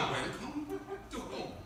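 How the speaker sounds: soft, high, drawn-out welcome; carcelero acogedor. Acted. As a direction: welcome him back in a mock-sweet jailer voice. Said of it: "Ahora sí le decía". (bienvenida burlona, suave y aguda)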